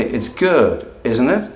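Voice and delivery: isn't it rising sharply high; rising tag. Shown as a demonstration. Teacher showing an unsure tag question. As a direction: lift isn't it up as a real question. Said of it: "I'm not one hundred percent confident".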